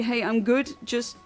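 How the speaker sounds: ordinary talk, nothing marked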